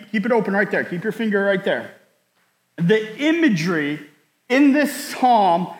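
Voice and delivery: ordinary talk, nothing marked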